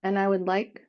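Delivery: unhurried meditation guide with long pauses; guided meditation. Acted. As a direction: ease them into stillness, leaving space between phrases.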